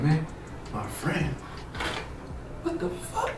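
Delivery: very soft, halting inner voice; baffled thinking. Retold or imitated. Man racking his brain over the accusation, near-whispered confusion. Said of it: "So I'm thinking".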